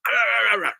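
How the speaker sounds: high, loud mock dog growling; imitated dog growl. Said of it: "And it goes".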